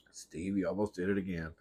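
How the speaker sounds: hushed whispered warning; whispering. Real, not acted. Scolding dog quietly.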